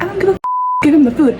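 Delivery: impatient manager. Retold or imitated. Manager orders worker to hand over food, mock impatient. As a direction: imitate a boss brushing off an objection.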